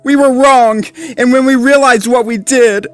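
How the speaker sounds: high, loud, guilt-stricken pup; remorseful cry. A narrator's reading. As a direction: plead for forgiveness. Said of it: "Rubble sniffed".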